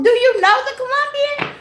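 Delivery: shrill high question in disbelief; disbelieving high-pitched question; high disbelieving squeal. Real, not acted. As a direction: ask it way up high, incredulous. Baffled by viewer's question.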